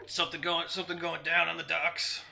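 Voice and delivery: loud, irritated orc voice; old orc. Acted. Old orc shouts from boat window at Thrug, irritated orc. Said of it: "the old orc kinda".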